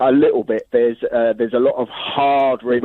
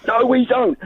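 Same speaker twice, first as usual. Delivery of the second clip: high-pitched mock yell; mock shouted denial; retold shout; 11 semitones higher than usual. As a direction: imitate an indignant shouter, pitch leaping up without much volume. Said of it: "And he, and he shouted".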